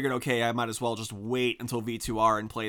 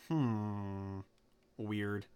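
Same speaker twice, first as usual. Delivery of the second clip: soft puzzled muttering to self; 4 semitones lower, 12 dB quieter, slower than usual. Real, not acted. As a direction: mutter it under your breath.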